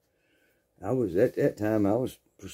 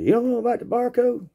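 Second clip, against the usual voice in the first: much higher, astonished Frank; mock disbelief; 4 dB louder than usual. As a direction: act shocked she doesn't know.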